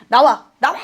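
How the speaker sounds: loud repeated command to come out; shouting at her own pride. Retold or imitated. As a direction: drive pride out with a shouted order. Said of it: "하면서 막 교만에 싸워붙이고".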